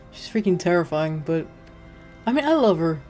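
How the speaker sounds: ordinary delivery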